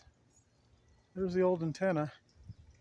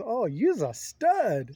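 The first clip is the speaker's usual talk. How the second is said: slow, high, louder put-on lady voice; imitating the lady; 7 semitones higher, 5 dB louder, much slower than usual. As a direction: drag it out high like her, admiring. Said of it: "She goes".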